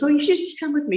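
very nonchalant. Retold or imitated. Friend casually inviting her to the clubhouse, nonchalant. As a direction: toss it off like it's nothing. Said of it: "You know, very nonchalant".

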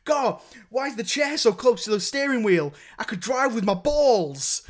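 retelling a comedy scene, a playful put-upon whine; mock indignant complaint. Acting out Partridge complaining while driving.

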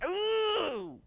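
high mock wail; a hammed-up cry of anguish; preacher imitating the damned, high wail. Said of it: "They're screaming".

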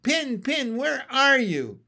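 high, loud searching call; frantic shouting. A narrator's reading. Frog leaping about, shouting frantically for Pin. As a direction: shout frantically for someone lost. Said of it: "I shouted, leaping about".

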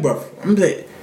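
usual speaking voice

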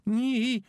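high yelp of fright; a sharp startled squeak; frightened squeak. Acted. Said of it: "made a noise like".

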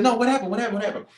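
high, rapid-fire mock insistence; insistent questioning. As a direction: press for the answer, imitating an eager friend. Retold pastor presses for an answer.

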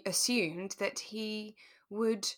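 ordinary talk

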